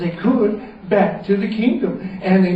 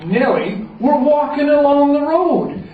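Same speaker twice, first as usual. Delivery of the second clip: plain reading voice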